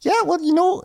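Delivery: very high, badly faked Michigan accent; Nicole. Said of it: "a Michigan accent, which I'm not really doing very well".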